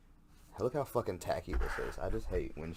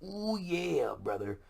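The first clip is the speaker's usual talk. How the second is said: much higher raspy wrestler growl; Macho Man Randy Savage; 10 semitones higher, slower than usual. Retold or imitated. Host imitating the wrestler's catchphrase, hammed-up promo voice. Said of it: "He's very much".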